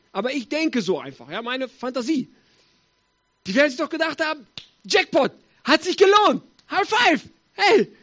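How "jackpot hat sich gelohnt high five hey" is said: high, loud triumphant shouts; jubilant cheering. Acted. Four friends after the healing, jubilantly. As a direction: celebrate like hitting the jackpot. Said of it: "riesig gefreut haben".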